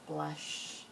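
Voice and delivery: ordinary delivery, nothing marked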